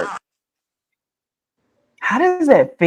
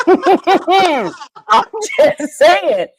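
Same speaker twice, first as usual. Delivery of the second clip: laughing; 7 semitones higher, much slower than usual. Real, not acted. Laughing over his own joke.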